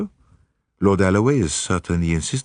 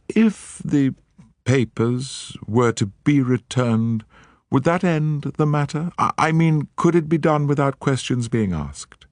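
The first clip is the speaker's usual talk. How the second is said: a lightly voiced, higher lady, halting; hesitant lady; 9 semitones higher than usual. Lady Juliet asking Poirot about the papers, halting. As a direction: feel your way through a hard request.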